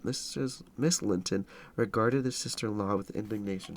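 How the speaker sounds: ordinary delivery, nothing marked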